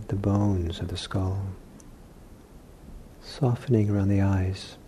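soft, unhurried meditation voice with long pauses; guided meditation. Real, not acted. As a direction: ease them gently into relaxation, leaving long silences. Leads guided meditation exercise.

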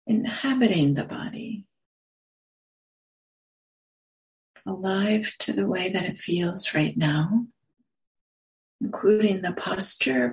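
unhurried meditation guidance with long pauses; guided meditation. Real, not acted. Meditation teacher settling listeners into posture and breath, guided meditation. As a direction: ease them gently into stillness, pausing between phrases.

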